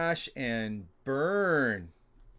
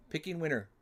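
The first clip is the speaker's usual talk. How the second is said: ordinary delivery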